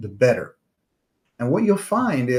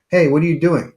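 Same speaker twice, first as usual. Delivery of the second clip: calling out; 4 semitones higher, 6 dB louder, faster than usual. Acted. Zen teacher challenges student.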